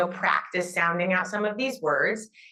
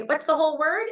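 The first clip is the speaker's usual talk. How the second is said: bright, high teacher cue to the class; cheerful classroom prompt; 8 semitones higher, faster than usual. Shown as a demonstration. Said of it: "and I would say".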